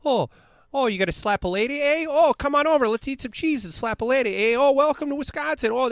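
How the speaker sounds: high, loud hammed-up Wisconsin yokel; mock Wisconsin accent. Bears fan mocking Green Bay locals in a hammed-up Wisconsin accent. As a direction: lampoon a cheesy Wisconsin local, eh.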